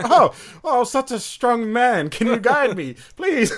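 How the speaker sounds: high, loud, hammed-up helpless pleading; pretend blind man. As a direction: gush over a stranger as a fake blind man, laughter close by. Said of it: "[name] was pretending to be blind".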